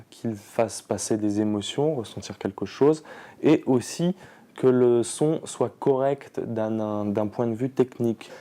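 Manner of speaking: normal talking voice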